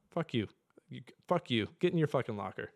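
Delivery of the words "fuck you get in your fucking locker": ordinary talk, nothing marked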